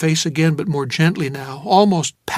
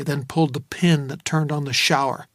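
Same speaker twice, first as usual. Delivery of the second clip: ordinary delivery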